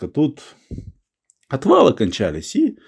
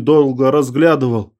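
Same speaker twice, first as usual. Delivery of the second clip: usual speaking voice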